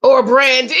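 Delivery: loud echo breaking into a high squeal of laughter; shouted laugh. Real, not acted. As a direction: yell it back then howl with laughter. Repeats show line, cracks up.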